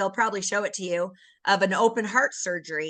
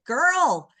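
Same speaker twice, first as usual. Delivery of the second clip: friend's firm nudge; 8 semitones higher, 4 dB louder, much slower than usual. Retold or imitated. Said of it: "And she said".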